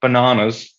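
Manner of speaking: "Bananas" with a long ah in the middle; "Bananas" said as ba-NAH-nas; British pronunciation. Retold or imitated. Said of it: "Every time I hear him say bananas".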